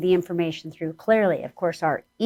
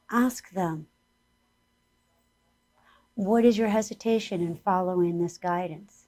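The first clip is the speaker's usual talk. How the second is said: guided meditation; 3 semitones higher than usual. Acted. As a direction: lead the listeners gently inward.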